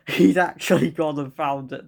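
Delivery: giggling through the words; real laughter breaking in; laughing. Real, not acted. Reading the stepdad twist, still laughing.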